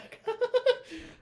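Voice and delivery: a sudden squeaky giggle; giddy high laugh; high squealing laugh. Real, not acted.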